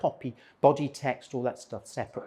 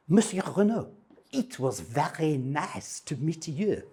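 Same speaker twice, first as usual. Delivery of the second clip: slow, hammed-up French accent, pitched up; outrageous French accent; 4 semitones higher than usual. Ken thanking his French host in a hammed-up French accent. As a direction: lay the French accent on thick as a thank-you. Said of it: "in an outrageous French accent".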